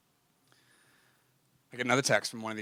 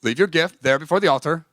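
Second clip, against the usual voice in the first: scripture reading; 3 semitones higher, 8 dB louder than usual. Acted. Reads Bible verse.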